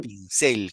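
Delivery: "Pincel" stressed on cel; teacher confirming stress on cel in "Pincel". Shown as a demonstration. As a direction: hit the final syllable of "Pincel".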